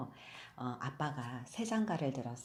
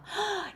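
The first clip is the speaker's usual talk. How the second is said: eager gasp. Acted. Mother eagerly meets daughter.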